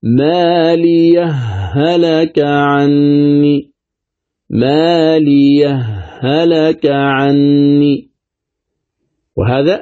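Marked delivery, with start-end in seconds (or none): 0.0-8.1 ما لي هلك recited slowly with the two ha merged into one doubled ha; تلاوة بالإدغام; idgham recitation. Shown as a demonstration. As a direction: run the ha of هلك into the next with no break. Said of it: "الإدغام".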